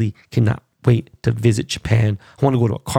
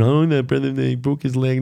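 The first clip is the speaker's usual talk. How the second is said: mocking whiny fan; 2 semitones higher than usual. Mocking Conor's defenders.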